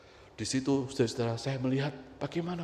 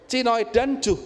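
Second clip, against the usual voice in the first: high, loud mimicked insult; makian tiruan; 9 semitones higher, 6 dB louder, slower than usual. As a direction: spit out the insult in a raised mock-angry voice. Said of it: "bayangkan seperti tadi ya". (makian pura-pura, tinggi dan keras)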